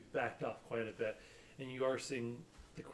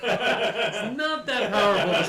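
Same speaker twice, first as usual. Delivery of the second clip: laughing; 9 semitones higher, 13 dB louder, slower than usual. Real, not acted. DM laughing at player.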